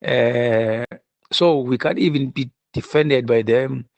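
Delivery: ordinary talk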